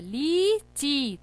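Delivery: लीची very slowly, both "ee" vowels stretched; long ई sounds. Shown as a demonstration. Teacher showing children both sounds, लीची slow and syllable by syllable. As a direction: draw out ली and ची in लीची. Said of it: "ई का sound आ रहा है".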